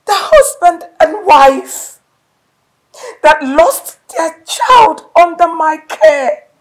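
hysterical shout. Acted. Wife screams at her husband about their daughter, hysterical. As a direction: scream your fear at him.

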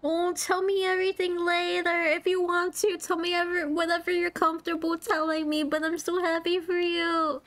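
high-pitched, teary delight; happy tears. Real, not acted. Congratulating a friend on a ring, happy tears. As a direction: gush in a high voice on the edge of crying. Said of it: "don't make me cry".

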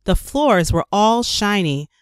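ordinary delivery

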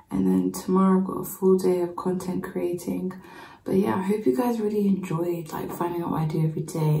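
ordinary talk

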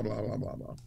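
normal talking voice

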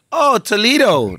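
slow, high "Oh, Toledo" with English vowels, drawn out; mock foreigner reads Toledo slowly; gringo reading it. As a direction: read Toledo like a puzzled English speaker. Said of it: "Yo le escribo".

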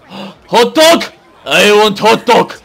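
very loud, high shouting; excited demand. Pretend. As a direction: yell greedily for food. Spots hot dog stand.

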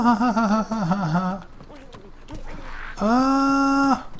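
high, frightened whimpering cries; high panicked cries. Acted.

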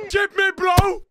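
high, loud call at the screen; loud urging. Real, not acted. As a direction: yell at the player to chip it. Urging in match.

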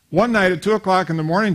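ordinary delivery

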